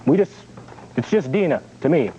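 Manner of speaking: ordinary talk, nothing marked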